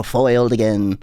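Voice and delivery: "Foiled again" in a lightly put-on cartoon villain voice; mock melodrama villain. Villain tying her to the tracks, lightly hammed-up sneer. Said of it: "tie her to the tracks with a mustache and go".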